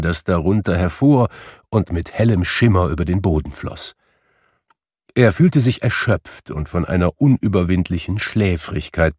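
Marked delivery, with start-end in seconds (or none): none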